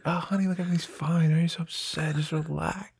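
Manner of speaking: soft, soothing, calming the husband down; soothing wife. Retold or imitated. Wife calming her upset husband, soft and soothing. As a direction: hush him gently, nearly murmuring. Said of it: "She's always trying to be like".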